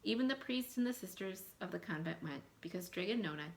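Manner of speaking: put-on reading a folktale aloud to children, lively; story narration. A narrator's reading. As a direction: tell it like a storybook to a class. Teacher reads folktale aloud.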